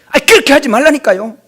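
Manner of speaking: loud, high exasperated shout; shouting professor. Retold or imitated. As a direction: yell at the student to stop doing it that way. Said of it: "막 소리를 지르는 거예요".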